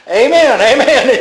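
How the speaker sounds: amen called out through a laugh; proclaimed with a laugh. Acted. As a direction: ring it out joyfully. Voicing Jesus, with a laugh.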